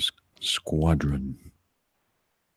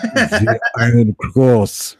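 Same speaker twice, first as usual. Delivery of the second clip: laughing mock villain; 5 semitones higher, 8 dB louder, much slower than usual.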